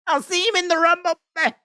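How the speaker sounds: a hint of Brock, rushed; Brock impression. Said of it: "That's my Brock impression".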